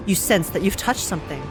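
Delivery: usual speaking voice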